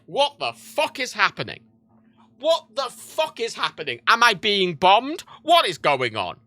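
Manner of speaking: slow, drawn-out bewildered alarm; incredulous alarm. Retold or imitated. Hearing explosions from bed, incredulous alarm. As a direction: stretch each question in mock panic.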